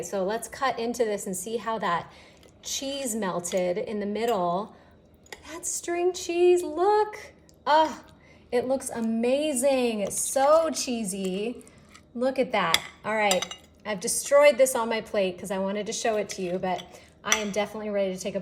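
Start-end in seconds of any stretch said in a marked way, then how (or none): 5.5-12.8 loud, thrilled and slow; excited. Real, not acted. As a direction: marvel at the cheese pull. Cheese stretching out.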